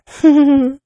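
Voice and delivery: a loud, bright mocking laugh. Mistress laughing at her pet's begging, a loud mocking laugh. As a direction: laugh at his begging, amused.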